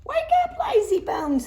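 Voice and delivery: high loud wake-up call; loud teasing high shout. Acted. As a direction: shout it up to the sleeping owl. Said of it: "they shouted".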